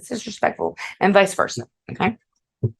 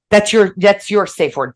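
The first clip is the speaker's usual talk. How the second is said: ordinary talk, nothing marked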